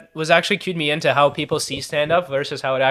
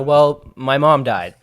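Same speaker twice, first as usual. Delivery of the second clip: slow deadpan; 2 semitones lower, much slower than usual. Acted. Deadpan line in monologue.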